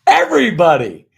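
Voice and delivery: loud insistent answer; emphatic. Retold or imitated. Answering the mentor with loud insistence. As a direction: declare it to everyone, loudly.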